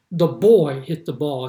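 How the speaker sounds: put-on "boy" said loud and slow, falling; stress on boy. Shown as a demonstration. Preacher putting emphasis on "boy" in a sample sentence. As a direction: slowly punch "boy" as the focus. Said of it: "I'm preaching then about the boy".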